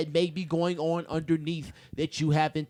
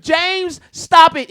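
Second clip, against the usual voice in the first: sharp raised call to stop; loud; 7 semitones higher, 9 dB louder, much slower than usual. Real, not acted. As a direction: cut him off loudly. Telling James to stop.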